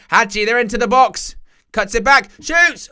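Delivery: high, loud build into a shouted call; excited commentary. Real, not acted. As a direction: call the shot like it's going in. Live commentary on a chance.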